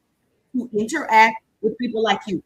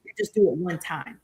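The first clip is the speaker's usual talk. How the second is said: ordinary talk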